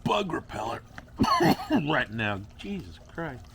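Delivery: usual speaking voice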